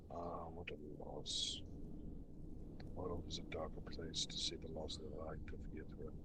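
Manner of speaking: low, soft, breathy ghostly lament; a lost spirit grieving over rubble, quietly; mournful ghost. Acted. Ghost laments the fall of Ythryn, low and whispery.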